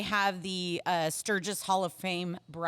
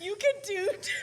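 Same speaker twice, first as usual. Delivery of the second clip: laughing; 12 semitones higher than usual. Real, not acted. Cracking up mid-sentence.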